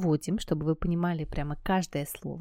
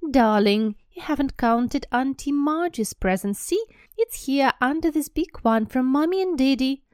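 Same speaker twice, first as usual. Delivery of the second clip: higher, louder, cooing and soothing; mother fussing over her son's presents; doting mother; 7 semitones higher, 5 dB louder than usual. Acted. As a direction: coo at the spoiled child to calm him. Mother soothing spoiled son.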